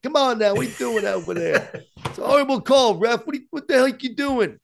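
high, pushy New York yell at the ref; New York accent, yelling at a bad call; Long Island ref heckler. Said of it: "I grew up with guys yelling like that".

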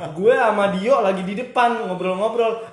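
ordinary delivery

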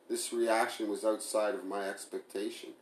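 ordinary delivery, nothing marked

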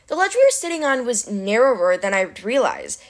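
plain reading voice

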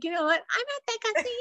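high mocking voice; mocking a rude caller. To coworker, mimicking the rude phone caller, high mocking voice. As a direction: parrot the caller's whine, pitched high. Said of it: "And I was like".

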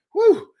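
a sharp high puff of breath after climbing stairs; high winded whew. Retold or imitated. Preacher acting out climbing stairs, winded whew. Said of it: "we be going up and we say".

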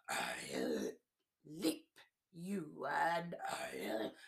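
slow, whispered tiger snarl. Acted. Tiger snarling at the paper dolls, slow and breathy. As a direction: creep it out like a tiger crouching to pounce. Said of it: "crouched and snarled and said".

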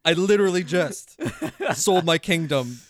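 half-laughing panicked king. Retold or imitated. Retelling the king's shock at his daughter, laughing through it. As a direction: laugh through the king's dismay, slowing on the last words. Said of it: "And the king's like".

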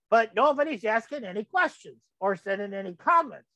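normal talking voice

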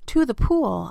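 eager storybook shout; urgent yell. A narrator's reading. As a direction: call it out to the crew. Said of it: "I yelled".

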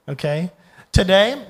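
ordinary talk

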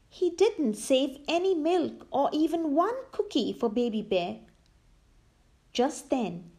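ordinary delivery, nothing marked

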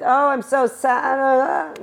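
slow, high, hammed-up "I'm so sad"; mock sad whimper. Imitating her younger self waking up, hammed-up sadness. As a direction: drag it out like a pouty mock lament.

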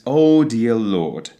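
slow British accent, dear and Lord without R; British expression. Shown as a demonstration. Teacher showing a typical expression, British accent. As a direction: stretch it out British style, dropping every R. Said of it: "typical expression the British might use".

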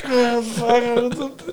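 high, strangled little panic; a squeaky, panicked gasp of dread; dismayed oh no. Retold or imitated. To himself as the buyer haggles, a high panicked groan. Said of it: "I'm like".

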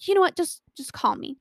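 high, loud burst, then dropping back; retold anger. Retelling her furious reply to his text, angry. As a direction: fire off an exasperated retort. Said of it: "I was so angry".

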